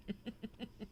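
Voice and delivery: quiet chuckle at his own joke; real soft giggle after the punchline. Real, not acted.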